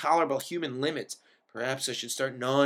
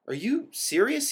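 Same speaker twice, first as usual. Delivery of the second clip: much higher, slow disbelieving question; can't believe what he's hearing; incredulous; 9 semitones higher than usual. A narrator's reading. Al asks Baxter in disbelief, incredulous high rise. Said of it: "he asked, incredulously".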